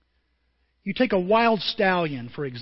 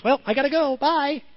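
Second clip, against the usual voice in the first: mimicking wife; 7 semitones higher, 3 dB louder than usual. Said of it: "And she goes".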